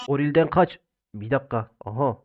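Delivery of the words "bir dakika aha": ordinary delivery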